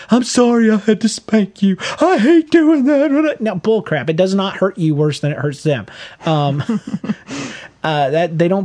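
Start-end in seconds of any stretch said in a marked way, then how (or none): 0.1-3.4 high, slow whiny apology, hammed up; mock sorrowful parent, high and drawn out; mock guilty parent. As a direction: mimic a parent pretending spanking hurts them more. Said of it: "Don't sit there".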